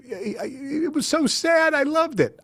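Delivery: high, slow, tearful stammer; sobbing. Retold or imitated. Crying woman explaining to the stranger she loved it. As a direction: gush it through sobs. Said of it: "And they're crying".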